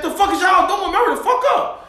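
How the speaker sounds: high-pitched, fed-up demand; changed, angrier tone. Acted. Yelling at the car holding up the ATM, exasperated. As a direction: escalate the complaint, pitch climbing high. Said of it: "Now my tone has changed".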